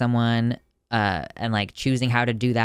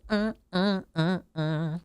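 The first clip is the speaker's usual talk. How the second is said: fake sobbing; 7 semitones higher, slower than usual. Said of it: "Trying not to cry".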